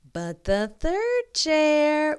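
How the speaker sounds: "third" stretched high and loud; building suspense. A narrator's reading. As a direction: draw out "third" to tease the kids. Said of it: "what do you think?".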